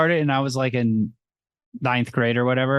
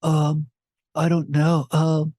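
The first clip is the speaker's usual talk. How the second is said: mock awkward boyfriend; 3 semitones higher than usual. Mocking nervous boyfriend character.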